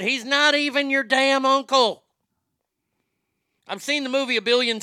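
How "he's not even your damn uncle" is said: high, loud snapped quote; a hint of an annoyed wife; lightly voiced irritated wife; quoting the wife. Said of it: "Cletus's wife said".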